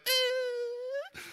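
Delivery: put-on very high, thin baby whine; baby squeal. Imitating one-year-old Raylan on the video call, high squeal. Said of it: "Raylan's like".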